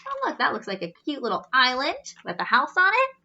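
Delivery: plain reading voice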